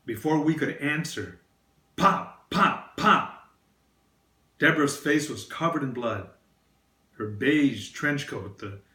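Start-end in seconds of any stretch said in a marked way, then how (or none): 2.0-3.5 loud, high, punched-out pops; imitated gunshots. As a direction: fire each word like a sudden gunshot. Gunshots imitated as shooting is retold.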